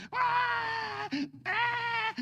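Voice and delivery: high, loud hammed-up crying wail; mock sobbing wail. Shown as a demonstration. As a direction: ham up a man bawling at a movie. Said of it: "É assim?". (choro exagerado e debochado, agudo e alto)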